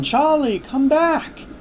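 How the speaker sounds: high calling voice from far off; doctor's voice calling from afar. Retold or imitated. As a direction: call her back as if from another place. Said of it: "the doctor's voice saying".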